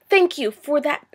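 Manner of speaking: ordinary talk, nothing marked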